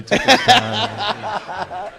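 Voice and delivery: drawn out slowly through laughter; laughing. Real, not acted. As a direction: stretch it out, laughing at the memory. Laughing over the memory.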